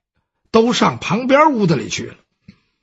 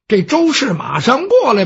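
ordinary delivery

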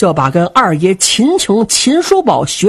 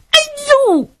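acted yelp of pain on landing; pained "aiyo" groan. Jin Jia thrown onto the sand, pained groan. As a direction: cry out as you hit the ground. 金甲摔在沙土上.

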